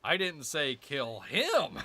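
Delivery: "him" stressed at the end of "I didn't say kill him", slow. Shown as a demonstration. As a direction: lean on "him". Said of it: "wherever you're emphasizing".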